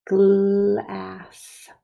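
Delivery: "Glass" drawn out slowly through the L and A; stretched-out glass. Shown as a demonstration. Teacher sounding out glass for pupils to write, stretched out. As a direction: linger on every sound of "Glass". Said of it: "as I stretch it out for you".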